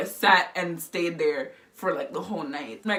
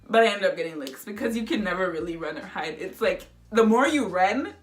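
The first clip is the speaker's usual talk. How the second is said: ordinary delivery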